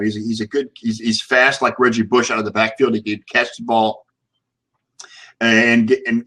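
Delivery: ordinary talk, nothing marked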